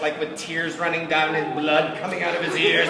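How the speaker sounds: ordinary delivery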